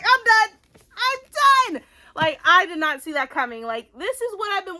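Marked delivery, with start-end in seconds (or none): 0.0-1.8 high, loud, worked-up outburst; reenacted high-pitched fangirl shock; overwhelmed exclamation. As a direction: blurt it high and loud, overcome by the twist. Retelling being overwhelmed by the twist.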